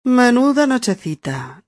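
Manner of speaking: complaining about a sleepless night, sarcastic; sarcastic complaint. Shown as a demonstration. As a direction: moan about the noisy neighbours with irony. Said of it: "O en sentido sarcástico".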